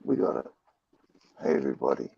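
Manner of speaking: croaky hoarse voice after a cold night; rough, croaky morning voice. Real, not acted. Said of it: "sorry, I sound like a fucking croaky frog".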